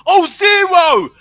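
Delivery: loud, high sarcastic shout; triumphant shout. Real, not acted. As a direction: yell it at the critics. Fan shouting trophy count.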